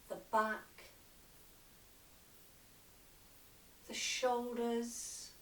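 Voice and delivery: slow yoga nidra guidance with long pauses; unhurried meditation voice, letting phrase endings sink; guided meditation. Acted. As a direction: lead them gently into relaxation, phrase by phrase. Leads a relaxation practice.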